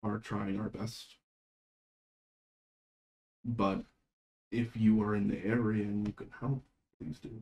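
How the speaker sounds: measured and quiet, with pauses between phrases; grave, weary goddess. Acted. Goddess answering a plea while pinned down, grave and soft. Game master voices the goddess's reply.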